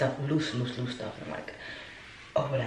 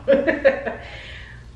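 high breathy burst of laughter; high airy laugh; 13 semitones higher, 8 dB louder than usual. Real, not acted. Vlogger laughs at doctor's reaction, high airy laugh. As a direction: laugh off the story.

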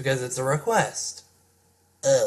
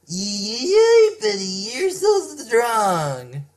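put-on cheering girl voice; 7 semitones higher, much slower than usual. Cheering bystander voice.

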